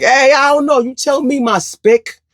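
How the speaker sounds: high-pitched hollered greeting; mock holler back. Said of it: "you hollered back".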